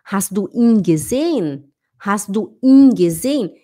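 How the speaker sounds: "ihn" stretched to a long "eeen"; slow question, lingering on the ee of "ihn". Shown as a demonstration. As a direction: hold the vowel in "ihn" clearly long. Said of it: "é um i longo".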